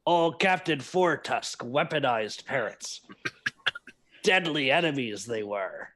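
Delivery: put-on drunken old pirate spinning his tale; swaggering put-on pirate brogue; Tom. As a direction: roll it out like a tipsy sea dog. Player voices drunken pirate character.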